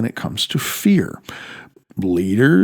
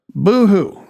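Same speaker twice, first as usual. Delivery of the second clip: a mocking fake sob, pitched higher; sarcastic boo-hoo; 7 semitones higher, 3 dB louder than usual. Mocking how powerful people complain, sarcastic boo-hoo. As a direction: sneer at their tears with a mock cry.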